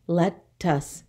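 "Lettuce" split in two, let-tuce; syllable by syllable. Shown as a demonstration. Teacher counting two syllables, "Lettuce" in two separate beats. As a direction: say "Lettuce" with a gap after the first syllable. Syllable lesson, word broken up.

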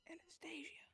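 unvoiced whisper of a name. Sneaking behind a friend in the game, whispered.

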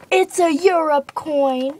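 a drawn-out proud reveal; excited reveal. Real, not acted. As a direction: announce the prize slowly. Big reveal of the coin.

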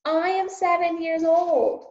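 put-on high loud child shout. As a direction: keep shouting after them. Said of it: "shouted Meg".

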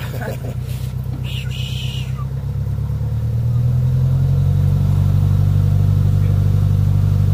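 a long, loud burst of laughter. Real, not acted. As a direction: laugh out loud, unable to stop. Long laugh at brake joke.

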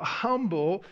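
usual speaking voice

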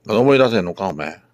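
loud mock scolding to a stubborn pet. Real, not acted. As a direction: raise your voice at the pet.

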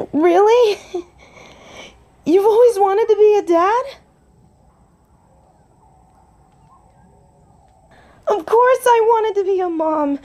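high, gushing happiness; delighted. Acted. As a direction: gush with joyful disbelief, then loving assurance. Delighted about becoming parents together.

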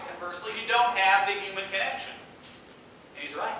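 usual speaking voice

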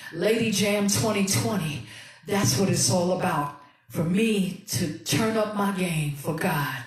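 ordinary talk

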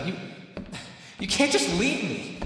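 high, cracking yells; desperate screaming plea. Acted. Caged vampire screams after the hunter, begging her to finish him off.

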